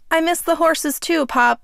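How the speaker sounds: fighting the tears, lightly voiced; a touch of held-back tears. Grandson answering his dying grandfather, mildly holding back tears. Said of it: "trying to hold back my own tears".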